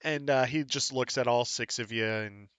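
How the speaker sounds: normal talking voice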